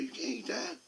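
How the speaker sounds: wife's voice. Retold or imitated. Wife scolding him at store.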